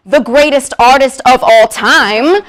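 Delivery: loud, slow grandiose billing; grand proclamation. Acted. Host billing [name] to the crowd, grand proclamation. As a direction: proclaim it like a big title, slowly and loudly.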